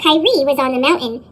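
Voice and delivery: ordinary delivery, nothing marked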